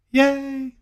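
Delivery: high, squealing yay; excited cheer. Real, not acted. Telling Dave about his new days off, a delighted squeal. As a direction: cheer like you just got great news. Happy about weekend off work.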